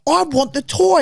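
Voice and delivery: loud, high mock tantrum; imitating a child's shrieked demand. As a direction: shout it like a spoilt kid. Demanding child voiced in story.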